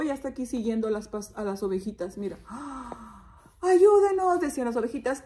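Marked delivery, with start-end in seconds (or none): 3.6-4.4 high, loud call for help; sheep crying out. Acted. Little sheep chased by the wolf, crying out. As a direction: yell as a panicked sheep. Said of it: "Todas están así gritando".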